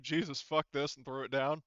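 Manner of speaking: ordinary talk, nothing marked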